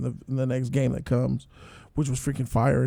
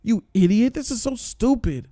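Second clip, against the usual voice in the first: exasperated scolding; 7 semitones higher than usual. Real, not acted. Viewer scolding the TV hero, high exasperation. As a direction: call him an idiot, fed up. Ranting at the character on screen.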